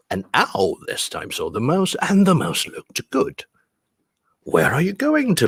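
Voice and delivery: lilting, swooping picture-book voice; story reading. Acted. Teacher reading owl meeting mouse, animated lilting storytelling. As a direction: read it to children with stretched key words.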